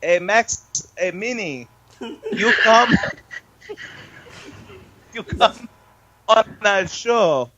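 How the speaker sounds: hammed-up fake Italian, slow and drawn out; a joking fake Italian accent; mock Italian accent. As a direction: ham it up like a cartoon Italian. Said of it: "In an Italian accent".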